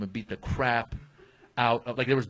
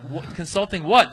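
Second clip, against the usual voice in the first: higher, louder incredulous question; mock-baffled retort, pitched up; 5 semitones higher, 7 dB louder than usual. Comic retelling his confusion at being told to pose as consultant, baffled.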